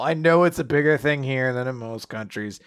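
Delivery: ordinary talk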